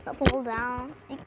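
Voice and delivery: ordinary talk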